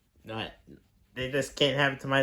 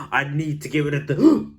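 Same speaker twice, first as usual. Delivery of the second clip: loud, higher, near tears; desperate cry; 5 semitones higher, 10 dB louder than usual. Acted. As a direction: wail it out desperately. Desperate to stop hiccups.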